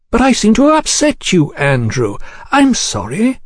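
high, courteous gentleman's apology; suave mock apology. Gentleman who has upset the diner, smoothly apologetic. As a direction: apologise with polished false concern, pitched high.

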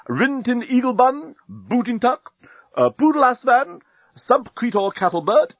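high-pitched military roll call; each name lifted high, like an announcement; captain presenting his troops. A narrator's reading. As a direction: reel off names like a captain on parade.

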